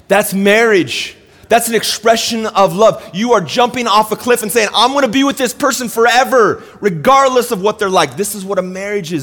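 high, loud, driving sermon voice; impassioned preaching. Real, not acted. Pastor tells church what covenant is, impassioned.

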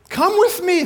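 high, loud summons; rousing call. Acted. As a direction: summon a follower to come along. Jesus calling followers along.

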